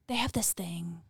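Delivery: retold whisper, hushed. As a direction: whisper it like a secret. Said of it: "whisper heard".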